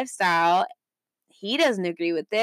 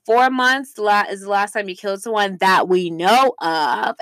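ordinary delivery

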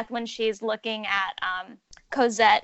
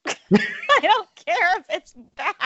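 laughing; 7 semitones higher, 5 dB louder, slower than usual. Real, not acted. Laughing at own opinion.

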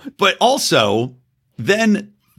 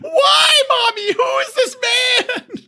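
put-on very high, drawn-out wailing child; hammed-up hysterical kid screaming for Mommy; mock tearful shriek in a tiny child's voice; terrified little girl; slower than usual. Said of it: "that's why she's just like".

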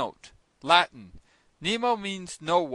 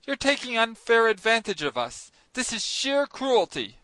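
flaring up; 6 semitones higher, 4 dB louder than usual. A narrator's reading. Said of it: "flaring up in spite of myself".

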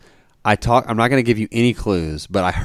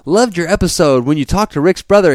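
higher-pitched, chummy fan pitch; imitated email writer; 8 semitones higher, 3 dB louder than usual. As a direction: imitate an overfamiliar booking email. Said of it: "these emails is gonna be like".

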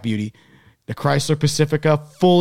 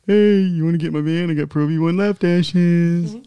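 mock sleazy van pitch; 5 semitones higher, 4 dB louder, slower than usual. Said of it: "like, like, you know".

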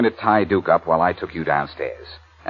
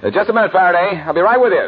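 high, loud call to the door; 9 semitones higher, 3 dB louder than usual. Acted. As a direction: stall him loudly through the door. Calling to visitor at door.

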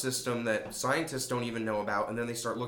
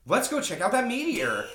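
high voice; 10 semitones higher, 5 dB louder than usual. Retold or imitated. Voicing couple in movie.

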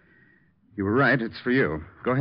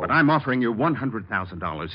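usual speaking voice

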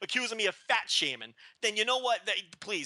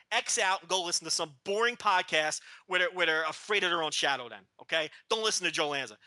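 ordinary delivery

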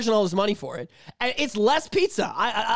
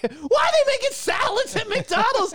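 Bill rant impression; 9 semitones higher than usual. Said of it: "He's like".

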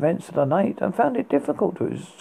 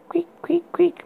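high breathy creak sounds; whispered creaking; 12 semitones higher than usual. Acted. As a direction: whisper the bed's creak eerily. Creaking bed sound imitated.

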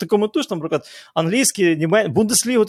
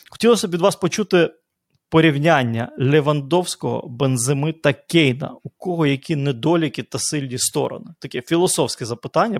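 ordinary talk

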